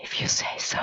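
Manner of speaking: hushed, voiceless whisper; ASMR whisper. Real, not acted. Streamer answering a no-ASMR request, whispering. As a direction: whisper it teasingly into the mic. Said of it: "A S M R us".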